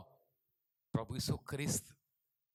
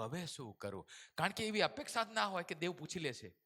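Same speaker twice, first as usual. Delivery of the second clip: normal talking voice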